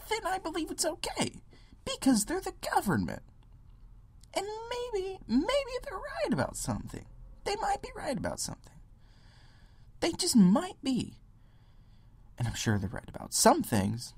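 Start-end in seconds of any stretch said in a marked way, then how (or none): 0.1-11.2 much higher, sing-song mock voice; mocking falsetto. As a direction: squeak it like a naive follower. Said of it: "they say".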